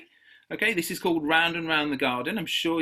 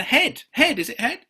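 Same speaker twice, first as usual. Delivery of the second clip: loud answer; 9 semitones higher, 7 dB louder than usual. Real, not acted. Calls out the quiz answer.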